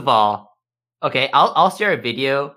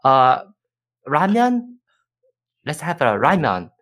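lightly raised, halting broken English; 2 semitones higher than usual. Retold or imitated. Song Joong-ki in a date skit suggesting ramen in broken English. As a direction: grope for simple English words. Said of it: "talking like that".

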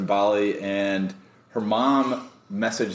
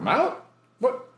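much higher, incredulous question; mock astonishment; 12 semitones higher than usual. Meeting her at midnight McDonald's, high astonishment. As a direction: squeak out the disbelief.

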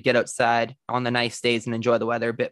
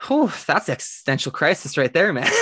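ordinary talk, nothing marked

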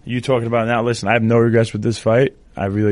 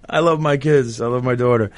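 real choked-up declaration of love; tearful; 4 semitones higher than usual. Real, not acted. Father speaking of his kids after breaking down, tearful. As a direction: push the words out through tears. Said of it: "Have I cried on your show before?".

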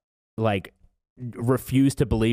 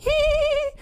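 short laugh; 3 dB louder than usual. Real, not acted. Laughs mid-sentence.

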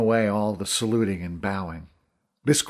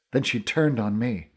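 usual speaking voice

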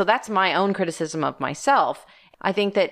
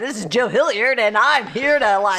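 louder, big announcer voice; mock him launching the show; hammed-up radio host impression; imitating a radio host; 2 semitones higher, 4 dB louder than usual. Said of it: "You're like".

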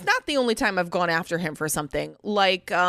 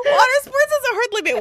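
high, laughing delivery; 5 dB louder than usual. Real, not acted. Joking about her limits to friends, high laughing squeal.

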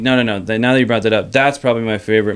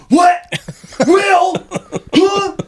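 shocked double take; 4 dB louder, much slower than usual. Retold or imitated. Son's shocked reaction imitated.

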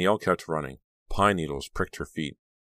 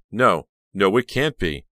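high, loud horror; raised, shocked denial; gasped disbelief; 4 semitones higher, 7 dB louder than usual. A narrator's reading. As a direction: recoil in horrified disbelief. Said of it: "She spun around and gasped".

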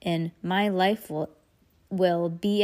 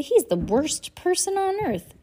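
higher, fierce disgust; 10 semitones higher, 3 dB louder than usual. Acted. Klaus condemning Captain Sham, fierce and higher. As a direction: spit out the contempt. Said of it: "Klaus said fiercely".

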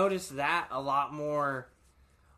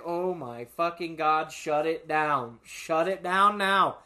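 urgent plea; 4 dB louder, slower than usual. Retold or imitated. Urging driver to stop.

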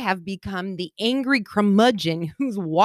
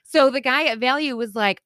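normal talking voice

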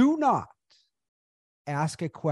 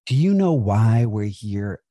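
slow and loud, leaning on "you"; loud, slow delivery; 8 dB louder, slower than usual. Shown as a demonstration. Host mimics a speaker opening a talk, loud and slow. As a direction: boom it out slowly to the room. Host performs the bad opener.

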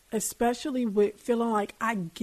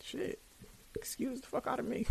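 muttered sarcastic retort, much softer; under-breath retort; 13 dB quieter than usual. Retold or imitated. To herself, scolded by a book: soft sarcastic grumble. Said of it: "And I'm like".

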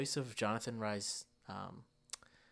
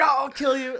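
loud, high mock-furious yell; hammed-up rage, voice shooting up; mad cartoon villain; 14 semitones higher, 13 dB louder, faster than usual. Villain losing it over the wallet, mock-furious yell. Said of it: "he gets so mad that".

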